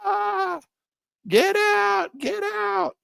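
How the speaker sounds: hammed-up frightened trader crying get out; mock panic selling. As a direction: squeal like a trader losing his nerve. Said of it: "Now they're panic selling".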